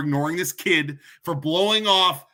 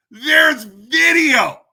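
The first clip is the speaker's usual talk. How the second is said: slow, much higher and louder shout; furious shout; 8 semitones higher, 8 dB louder, much slower than usual. Real, not acted. Shouting at the diocese that video exists, slow furious shout. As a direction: yell it out, hammering home the proof. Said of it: "and there's video".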